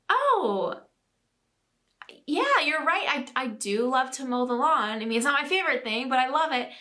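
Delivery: dad's voice done high and loud, reassuring; imitating dad. Dad reading his child's card, high and loud. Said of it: "And he's like".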